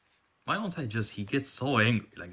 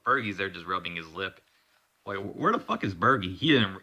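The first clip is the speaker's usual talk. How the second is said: ordinary delivery, nothing marked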